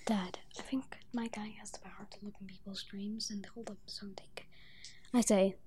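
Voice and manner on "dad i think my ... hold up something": hushed whisper to dad; whispered aside. Real, not acted. Kid player privately asking dad about a power, whispered aside.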